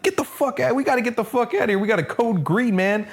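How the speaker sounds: high, hurried mock-panic voice; panicking dad. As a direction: rush it out like a frantic father. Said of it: "He's like".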